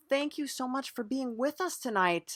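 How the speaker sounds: ordinary talk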